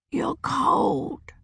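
put-on high, soft old crone murmur; a dying witch feeling the child's touch; faint crone voice. A narrator's reading.

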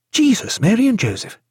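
high-pitched exclamation of amazement; startled oath, pitched high; astonished cry. A narrator's reading. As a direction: exclaim it as the sight sinks in. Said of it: "cried Stephen".

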